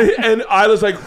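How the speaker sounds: ordinary talk, nothing marked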